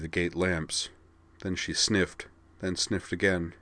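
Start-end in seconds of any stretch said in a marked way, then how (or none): none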